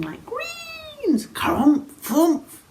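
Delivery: squealed high, then grunted dragon sounds; funny dragon noises. Acted. Storyteller imitates the dragon's funny sounds, silly noises. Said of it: "what funny sounds he's making".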